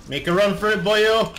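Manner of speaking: rallying shout. Pretend. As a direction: hurry them on with a shout. Urging teammate in a put-on tone.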